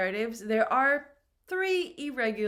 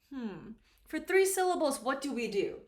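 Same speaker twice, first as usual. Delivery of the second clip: normal talking voice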